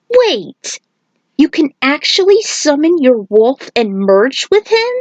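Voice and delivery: high, startled disbelief; jaw-dropped surprise. Acted. Kung Lao stunned that Nightwolf merges with his wolf, high and startled. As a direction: gasp it out, floored by the news.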